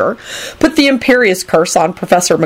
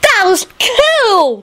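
very high, drawn-out delight; slow, squeaky glee; happy; much slower than usual. A narrator's reading. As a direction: gush happily in a tiny high voice. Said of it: "Teddy said happily".

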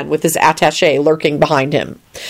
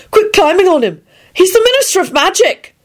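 high-pitched, scandalised protest; shocked scolding; 14 semitones higher than usual. A narrator's reading. Neville scolds Teddy climbing on the Minister, shocked. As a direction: exclaim it high, utterly aghast. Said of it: "so shocked he had finally found his voice".

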